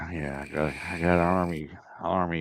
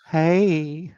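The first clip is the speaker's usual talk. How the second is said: high, laughing casual greeting; lazy Air Force "hey"; 8 semitones higher, 4 dB louder than usual. Retold or imitated. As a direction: toss it off like a relaxed airman. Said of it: "In the Air Force is".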